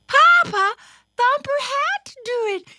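put-on very high, loud child voice; pleading little sister. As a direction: pipe up squeakily in your brother's defence. Little sister defends Thumper to father.

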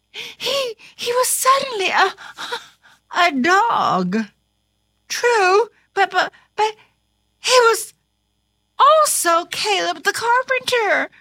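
high, halting, stammering disbelief; flustered panic. A narrator's reading. Carpenter suddenly a dog, flustered and stammering. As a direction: stammer it, breaking off between phrases.

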